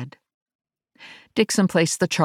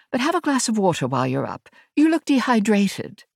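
higher-pitched Englishwoman's voice; English accent; 8 semitones higher than usual. A narrator's reading. Ash introduces herself to Verity, English accent. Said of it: "a woman with an English accent".

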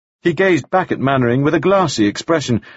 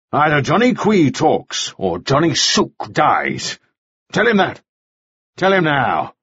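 higher-pitched, insistent menace; forceful threat; 4 semitones higher than usual. A narrator's reading. Mannering threatens Ah Suk at gunpoint, forceful threat. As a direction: press each demand as an order.

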